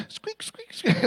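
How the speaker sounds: high breathless squeak, squeak, squeak; squeaky pedal boat noise. Retold or imitated. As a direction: mimic the creaky pedals in a thin hiss.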